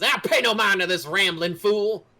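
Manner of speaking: loud, high dismissive yell; dwarf shouting back. Acted. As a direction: bellow it to drown out the accuser. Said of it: "Grin says".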